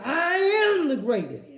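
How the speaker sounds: high, loud, slow, every word hit hard; fired-up proclamation. Shown as a demonstration. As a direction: declare it like a champion. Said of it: "He got involved".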